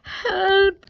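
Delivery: high, loud, panicked scream; a boy's terrified scream for help. Acted. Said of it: "he screamed".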